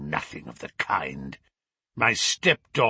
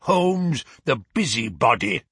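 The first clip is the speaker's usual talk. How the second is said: mounting contempt; scornful insult; 4 dB louder than usual. Acted. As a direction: throw the name out, then the insult.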